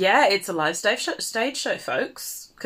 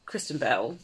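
normal talking voice

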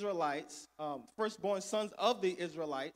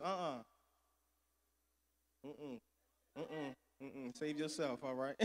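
softer, lower murmured aside; muttered aside; 6 semitones lower, 9 dB quieter than usual. Retold or imitated. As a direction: shake your head and murmur it. Mocking the culture's advice.

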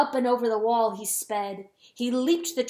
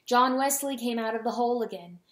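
plain reading voice

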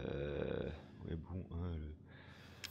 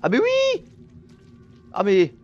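high, loud eureka cries; yelling with sudden recognition; excited shout; 10 dB louder than usual. Real, not acted. Gamer shouting as he remembers the crank's use.

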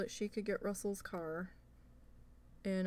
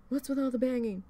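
retold demand; 5 semitones higher, 6 dB louder than usual. Confronting mother-in-law over noise.

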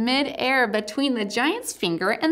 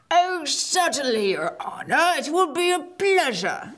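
lightly voiced humble giant, a bit higher; 6 semitones higher than usual. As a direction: play the simple, polite giant. Giant character voice.

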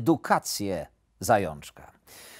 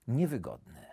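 ordinary delivery

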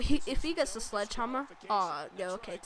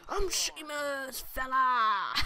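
Sheamus taunt; much slower than usual. Retold or imitated. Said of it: "all the guys' taunts".

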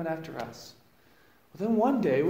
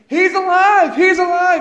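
much higher and louder, bursting with news; joyful shout; 14 semitones higher, 16 dB louder than usual. Retold or imitated. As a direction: cry it out as she bursts into the room. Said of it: "she started saying".

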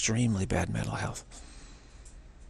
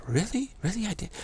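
mock surprised woman; 11 semitones higher than usual. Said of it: "She goes".

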